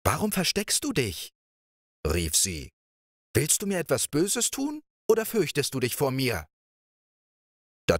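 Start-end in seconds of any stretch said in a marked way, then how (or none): 0.1-1.3 put-on higher, bright girlish princess voice; a princess calling out in surprise; startled princess. Princess calls to Pechvogel. 3.4-6.5 put-on higher, bright girlish princess voice; a princess calling out in surprise; startled princess. Princess questions him.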